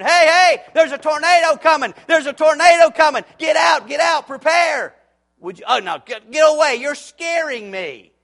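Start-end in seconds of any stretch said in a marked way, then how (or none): 0.0-4.9 much higher and louder, shouting the alarm at the door; frantic warning yell. Retold or imitated. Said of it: "I yelled and screamed". 5.7-8.1 higher and louder, recoiling from the warning; startled homeowner. Retold or imitated.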